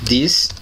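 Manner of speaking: This said slowly and clearly for students to copy; teacher modeling This for class, clear and careful; model pronunciation. Shown as a demonstration. Teacher shows students how to say This, clearly. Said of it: "Colocar aqui a pronúncia como eu faço".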